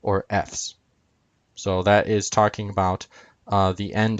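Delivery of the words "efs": Fs said efs; ending sounded out. Shown as a demonstration. Teacher compares word endings, Fs said efs. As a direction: say Fs as short eh plus fs. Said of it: "compared to epsilon upsilon sigma".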